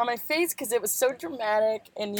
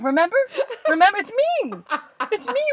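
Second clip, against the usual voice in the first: building higher and louder; mock-desperate, high-pitched insistence; hammed-up pleading reminder; 10 semitones higher, 7 dB louder than usual. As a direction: beg to be recognised, comically. Acting out reunion with waxer.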